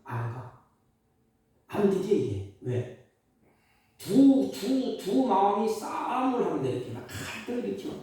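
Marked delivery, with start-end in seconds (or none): none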